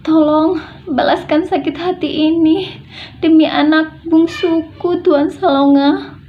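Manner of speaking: high, drawn-out plea; pleading. Shown as a demonstration. Begging Tuan Salonga to avenge a youngest child, imploring. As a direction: implore him for a favour you desperately need. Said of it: "intonasi memohon".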